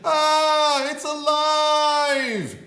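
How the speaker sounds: high, loud, slow shriek; hammed-up monster-movie terror; terrified scream. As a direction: scream in horror. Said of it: "The man screamed".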